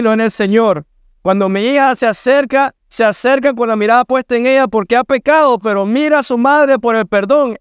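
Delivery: high-pitched pulpit urgency; urgent preaching. Acted. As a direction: warn the flock they are looking at themselves. Preacher raising pitch, exhorting.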